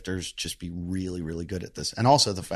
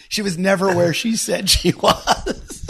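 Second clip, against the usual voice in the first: laughing quote; 8 semitones higher, 5 dB louder, slower than usual. Retold or imitated. Quoting friend, laughing.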